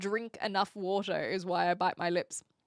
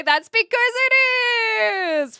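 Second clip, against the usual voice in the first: high, loud, stretched-out mock punchline; hammed-up comedian's comeback, rising and loud; stand-up comic punchline; 10 semitones higher, 10 dB louder than usual. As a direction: belt it out like a hammy comic. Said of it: "train as a stand-up comic and reply".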